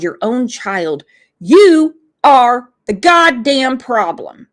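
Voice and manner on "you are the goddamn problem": loud, furious condemnation; angry outburst. Real, not acted. Ranting at neglectful parents, loud angry outburst. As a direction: hammer it home, loud and fed up.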